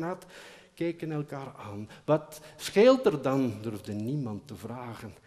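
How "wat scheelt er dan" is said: a slow, louder call upward; asked together. Acted. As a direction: call up all at once, worried. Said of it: "Dus vroegen ze het tegelijk". (samen luid roepend)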